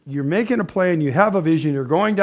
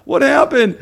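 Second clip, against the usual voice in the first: mock panicked prayer; 8 semitones higher, slower than usual. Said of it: "I didn't go home and go".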